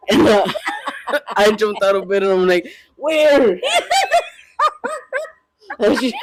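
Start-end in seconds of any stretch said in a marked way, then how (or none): 3.0-3.6 high, sharp, alarmed question; startled where. Retold or imitated. Husband jolted awake hunting for the crab, high and alarmed. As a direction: snap awake and demand where.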